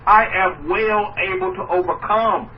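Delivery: I am well able to overcome said slowly and firmly; spoken slowly, like a confident vow; slow, deliberate declaration. Shown as a demonstration. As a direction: lean on each word of I am well able to overcome. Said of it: "You see? The difference between positivity and negativity".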